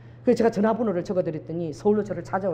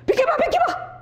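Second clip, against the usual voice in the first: much higher frantic yell; pushy shout; 12 semitones higher than usual. Retold or imitated. Weeping woman shoving past a passenger, frantic high yell. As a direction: shriek at them to move aside. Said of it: "그러고 막 그 사람 손을 막 잡아 빼는 거야".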